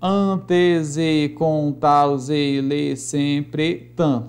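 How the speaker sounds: very slow, stretched syllables; measured metrical chanting; syllable-by-syllable scansion. Shown as a demonstration. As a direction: draw out every beat of the verse. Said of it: "vamos escandear aqui alguns versos".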